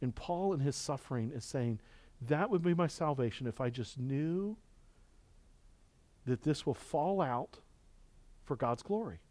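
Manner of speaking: hushed reading. Acted.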